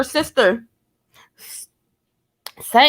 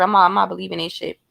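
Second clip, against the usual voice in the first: ordinary talk, nothing marked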